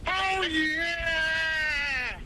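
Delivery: ecstatic shout. Acted. Ecstatic reply to each item.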